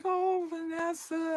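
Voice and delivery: high, slow, soft moan of a name; pained greeting. Retold or imitated. Limping manager greets Vanessa at her desk, pained. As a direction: draw it out wearily, imitating someone in pain.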